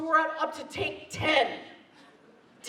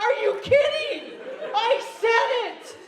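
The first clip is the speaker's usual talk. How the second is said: exasperated disbelief; 11 semitones higher, slower than usual. Retold or imitated. Actor reliving botched take, disbelieving. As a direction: cry out in mock despair.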